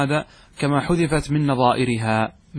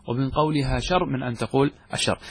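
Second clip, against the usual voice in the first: ordinary talk, nothing marked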